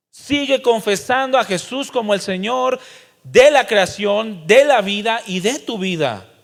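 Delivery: loud, high, impassioned sermon; a preacher thundering warnings at the congregation; fiery preaching. Acted. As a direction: preach it out at full volume. Preaching at full force.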